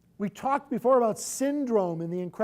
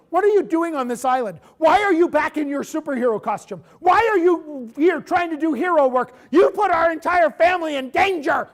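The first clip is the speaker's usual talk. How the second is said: raised, louder scolding as the angry wife; mock angry wife; 7 semitones higher, 8 dB louder than usual. Wife confronting husband on the island, mock angry scolding. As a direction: scold him like a wife he put in danger. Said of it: "she's like".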